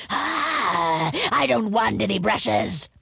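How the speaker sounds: rough monster growl-roar; dragon's roar. A narrator's reading. Dragon refuses the peddler's brushes, roaring. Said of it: "the voice roared".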